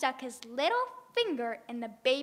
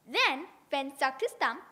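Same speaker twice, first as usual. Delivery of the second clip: ordinary delivery, nothing marked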